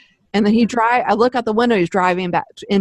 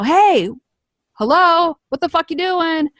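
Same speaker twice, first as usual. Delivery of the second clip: high-pitched, insistent calling out; mock yell to rouse someone; retold yell; 8 semitones higher, slower than usual. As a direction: call out high and exasperated. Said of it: "straight up yell".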